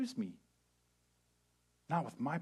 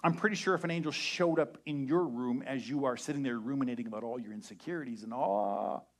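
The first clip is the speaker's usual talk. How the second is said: ordinary talk, nothing marked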